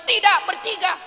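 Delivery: high loud refusal; firm refusal. Acted. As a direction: correct her forcefully. Yusuf refuses. (bantahan keras dan tinggi)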